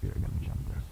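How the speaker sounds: breathy whisper, almost no voice; whispered to self. Real, not acted. Gamer whispering to himself before a tricky jump. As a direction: whisper it to yourself in focus.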